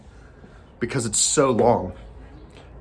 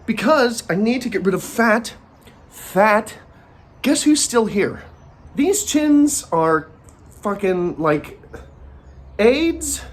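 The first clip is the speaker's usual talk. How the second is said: high, slow exasperated complaint; exasperated rant; 8 semitones higher, slower than usual. Real, not acted. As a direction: drag it out in exasperation. Mock rant about chins.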